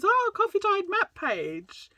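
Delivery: very high, slow, thrilled imitation; delighted friend. Friend unpacking her order on stream, very high delighted mock squeal. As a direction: squeal with delight at an unexpected gift. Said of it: "she goes".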